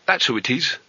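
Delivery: plain reading voice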